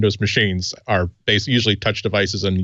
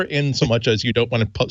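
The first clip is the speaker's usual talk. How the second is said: normal talking voice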